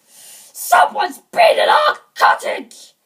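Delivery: slow, huge growling shout; angry bear growl. A narrator's reading. Father Bear searching the cottage, angry growl. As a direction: roar it like a furious bear. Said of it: "he growled".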